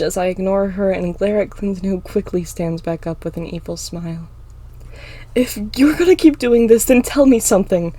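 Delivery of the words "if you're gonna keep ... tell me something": high, loud challenge; yell. A narrator's reading. Emma tries to stall the fight, yelling. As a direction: yell to hold off an attacker. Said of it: "I yell, trying to stall".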